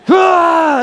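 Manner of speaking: a loud, high, hammed-up angry "Ah"; mock rage cry. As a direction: imitate a child's sudden rage scream. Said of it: "the rage come up".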